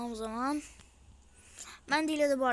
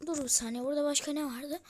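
usual speaking voice

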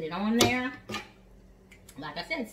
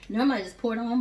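usual speaking voice

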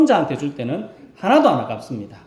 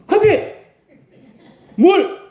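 curt, higher, louder barked order; bossy demand; 13 semitones higher, 5 dB louder, slower than usual. Retold or imitated. Elderly member ordering a younger deaconess, curt demand. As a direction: snap it out like an entitled elder. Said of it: "딱 끝입니다".

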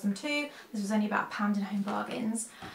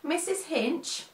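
mock Australian accent; 7 semitones higher, much slower than usual. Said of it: "Let's just flow with it".

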